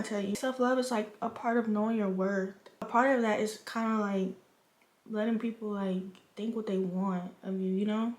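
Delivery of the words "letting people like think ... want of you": ordinary talk, nothing marked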